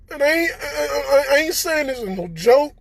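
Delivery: crying. Real, not acted. Tearful recounting of illness.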